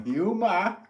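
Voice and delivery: mock New England "hyoo-MAH", stretched out; New England accent. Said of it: "Your accent just came out, uh".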